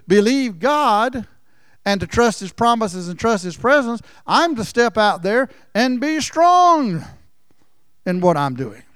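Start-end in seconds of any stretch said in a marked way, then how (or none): none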